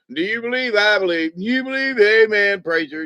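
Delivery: put-on high, loud hollering drunk in the back pew; drunk revival shouting. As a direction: whoop it up like a drunk at church. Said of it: "he's sitting in the back pew".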